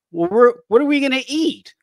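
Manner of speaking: high, loud exaggerated worry; hammed-up whiny bewilderment; mock fretting. Mocking apostles who forget the miracles.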